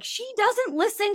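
high, put-upon owner complaining about her dog; exasperated dog owner. Retold or imitated. Lady walking her dog warns the passerby, high and put-upon. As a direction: sigh it out like a fed-up owner. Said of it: "the lady came across the street and she's like".

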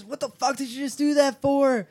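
high-pitched indignant protest; mock freak-out. Blob to Bleeb after being peed on, mock freak-out. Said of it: "And starts freaking out".

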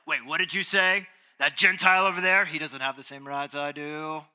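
put-on loud, indignant British-accented objector; mock British accent. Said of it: "he has a British accent".

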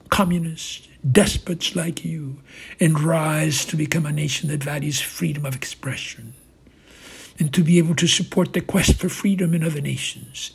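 building passion. A narrator's reading. Navalny's rising impassioned speech.

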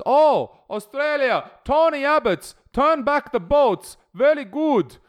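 very high, slow, hammed-up mock official; pleased and approving in a caricatured voice; approving caller voice. Official approving of the Australian newcomer, high slow caricature.

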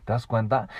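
ordinary delivery

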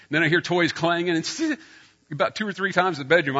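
normal talking voice

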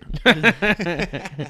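a loud real laugh that breaks out; burst of laughter. Real, not acted. Laughing at his friend's joke, loud real laughter.

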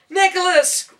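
high piercing scream of his name. Acted. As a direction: shriek his name as the rope snaps. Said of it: "Rachel screamed".

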